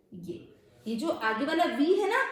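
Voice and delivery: ordinary delivery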